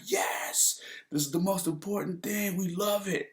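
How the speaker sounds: high, squealed delight; mock celebration. Family in car celebrating free parking, gleeful. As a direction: cheer it in a high, giddy voice.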